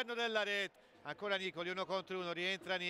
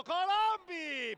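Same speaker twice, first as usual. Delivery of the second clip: shouted call; 7 semitones higher, 6 dB louder, much slower than usual. Real, not acted. Chance, sudden call.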